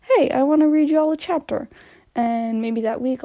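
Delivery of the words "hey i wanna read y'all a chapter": upbeat vlog greeting. Pretend. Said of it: "I'll just be like".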